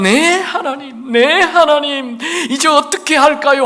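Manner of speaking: high slow crying plea; weeping prayer. Acted. Preacher voicing David weeping before God, slow high plea. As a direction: sob the words out to God. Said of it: "하며 우는 거예요".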